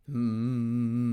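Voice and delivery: a steady buzzing mm on the vocal cords; sustained hum. Shown as a demonstration. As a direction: hum it and feel the vibration. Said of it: "that's the mm".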